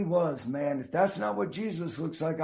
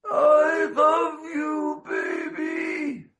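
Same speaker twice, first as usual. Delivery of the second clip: very high, very slow, tearful cry of love; mother's last words; 11 semitones higher, 4 dB louder, much slower than usual. Retold or imitated. Son retells dying mother's last words, high tearful cry. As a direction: stretch it out like a final tender cry. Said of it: "And she went".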